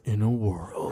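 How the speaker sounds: a hint of the epic movie trailer narrator; Don LaFontaine trailer voice. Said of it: "A movie trailer that says".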